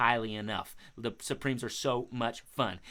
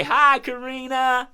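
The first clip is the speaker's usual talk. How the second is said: slow, raised, louder hello; calling a greeting; 10 semitones higher, 9 dB louder, slower than usual. Pretend. Host leading children to greet the crow, calling out. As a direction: lead the crowd in a drawn-out hello.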